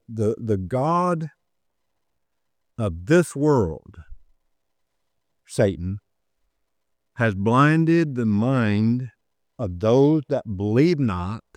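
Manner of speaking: very slow solemn verse reading; scripture read very slowly; slow scripture quote. Real, not acted. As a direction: lay out each word of the verse. Quoting a Bible verse.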